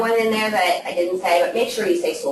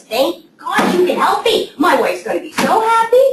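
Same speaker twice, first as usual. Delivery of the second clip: delighted caller; 11 semitones higher than usual. Retold or imitated. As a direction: squeal it out like an overjoyed client. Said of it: "he goes".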